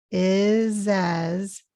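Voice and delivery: IS AS joined, both ending in Z; linked Z sounds. Shown as a demonstration. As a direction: buzz both S's in IS AS as Z. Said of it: "this is also a Z sound".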